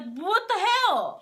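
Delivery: high, drawn-out disbelief; shocked outburst. Retold or imitated. To her boyfriend handing the CD back, shocked outburst. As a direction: stretch it out, stunned and upset.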